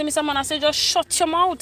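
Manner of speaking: heated, deliberate shut your mouth; retold angry retort. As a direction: scold the man hard. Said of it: "So I say, I say".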